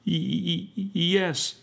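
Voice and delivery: put-on high, stammering child's voice; read-aloud sobbing stutter; sobbing boy. A narrator's reading. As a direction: stammer it as a tearful little boy. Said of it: "sobbed the little boy".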